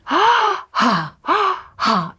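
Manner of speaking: high gasped breaths while moving the body; big audible in-and-out breaths; loud breathing. Shown as a demonstration. Teacher breathes out loud while showing how to move. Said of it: "breathe out loud like this".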